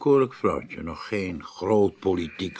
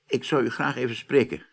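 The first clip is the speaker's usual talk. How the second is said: normal talking voice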